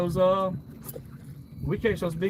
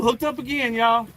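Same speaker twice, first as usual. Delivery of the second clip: loud, higher excited call; 7 semitones higher, 5 dB louder than usual. Real, not acted. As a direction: announce the catch, pumped up. Fish hooked, cheering viewers.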